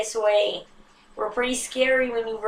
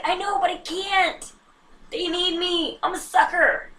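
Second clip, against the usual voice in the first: much higher, pleading reply; own whiny reply; 9 semitones higher than usual. Retold or imitated. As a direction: plead in a high, helpless voice. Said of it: "It's just like".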